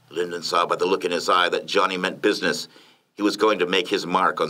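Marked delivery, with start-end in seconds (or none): none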